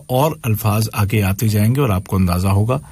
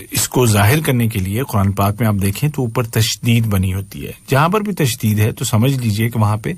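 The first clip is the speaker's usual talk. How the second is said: normal talking voice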